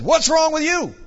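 loud, high drill-sergeant demand; no-nonsense barked challenge. Retold or imitated. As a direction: shout it like a Marine instructor confronting a recruit. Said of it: "He is a former Marine drill sergeant".

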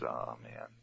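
very low, very soft disappointed sigh; deflated groan. Retold or imitated. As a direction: let it sink in defeat. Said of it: "And Balak says".

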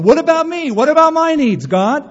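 high, loud whining complaint; selfish whine. Retold or imitated. Preacher mocking Jonah's self-centred prayer, high loud whine. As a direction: whine like a spoiled child.

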